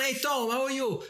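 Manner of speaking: a hint of a different high voice; voiced character. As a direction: greet Tom as the other person. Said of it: "not only did he do the voices".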